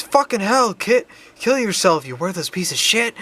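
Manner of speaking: high-pitched mock yell of abuse; loud retold outburst. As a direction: imitate a coworker's sudden loud cursing. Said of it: "loudly s-, said the phrase".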